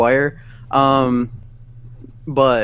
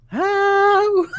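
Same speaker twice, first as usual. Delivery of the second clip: baffled. Retold or imitated. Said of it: "You're just like".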